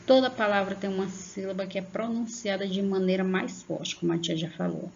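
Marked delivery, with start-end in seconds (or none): none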